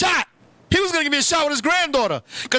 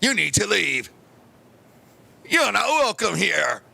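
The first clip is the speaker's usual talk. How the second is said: stern father; 5 semitones lower, slower than usual. Retold or imitated. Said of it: "He was like".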